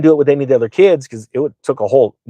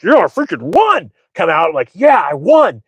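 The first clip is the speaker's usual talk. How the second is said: triumphant crowing; 5 semitones higher than usual. Retold or imitated. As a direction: crow about winning the argument. Acts out triumph at winning argument.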